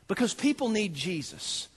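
ordinary talk

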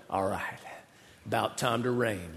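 a quiet knowing murmur; satisfied aside. Retold or imitated. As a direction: Elijah hearing of the cloud, low and soft. Elijah seeing the cloud.

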